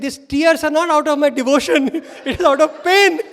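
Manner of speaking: high, loud, breaking into laughter; mock anguish through laughs; laughing protest. Boy's protest retold through laughter.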